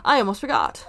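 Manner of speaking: ordinary talk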